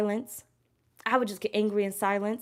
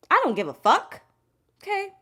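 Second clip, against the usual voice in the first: louder, blunt defiance; defiant outburst; 10 semitones higher, 5 dB louder than usual. Real, not acted. Answering people who say she changed, defiant and loud. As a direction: throw it back at the critics.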